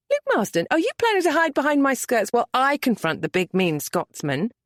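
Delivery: teasing Merritt. A narrator's reading. Merritt teasing Luke about hiding behind her skirts, high playful voice.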